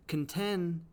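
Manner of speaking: "contend" drawn out slowly, stressed on the second syllable; iambic stress. Shown as a demonstration. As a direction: say "contend" slowly as weak then strong. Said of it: "is an I am".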